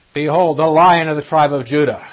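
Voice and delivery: Behold, the Lion of the tribe of Judah called out loud and high; elder's shout. Acted. The elder announcing to John, a loud ringing proclamation. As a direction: proclaim it like a herald stunning the hearer. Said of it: "Else the elder says to you".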